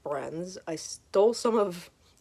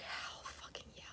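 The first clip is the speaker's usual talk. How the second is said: whisper; 21 dB quieter than usual. Retold or imitated. Inner thrill at free stickers.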